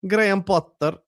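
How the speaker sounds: put-on "Graham Potter" said with a Swedish accent as "Grajan Potter"; lightly put-on Swedish accent, rolled r; a hint of a Swedish announcer. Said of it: "I can do a little bit Swedish accent".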